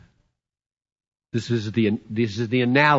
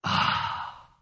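a soft whispered "ah" of quenched thirst; satisfied "ah"; 6 dB quieter than usual. Pretend. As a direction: breathe out content after drinking. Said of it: "lift up your face out of the stream and say".

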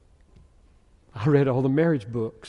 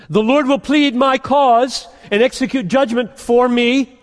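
higher and louder, ringing proclamation; preaching at full force; 10 semitones higher, 9 dB louder than usual. Acted. Preacher proclaiming Micah's hope to the church, full-force preaching. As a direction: thunder the verses out to the congregation.

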